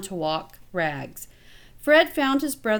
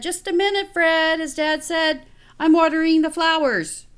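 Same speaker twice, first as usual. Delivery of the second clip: higher, louder call from the garden; father calling back while busy, higher and louder; busy dad; 7 semitones higher, 5 dB louder than usual. A narrator's reading. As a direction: call it out over the watering.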